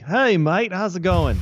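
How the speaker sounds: high, loud imitation of a broad Australian greeting; doing the painter's broad Australian accent, pitched high and loud. Host imitates the Australian painter greeting him at the office, in a high, loud Australian accent. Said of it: "the most Australian sounding Australian".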